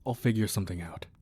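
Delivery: ordinary talk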